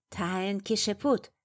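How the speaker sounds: a touch of hoarse insistence; demanding hoarse rasp. A narrator's reading. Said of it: "требовательно прохрипела она".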